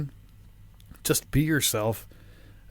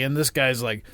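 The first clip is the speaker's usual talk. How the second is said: normal talking voice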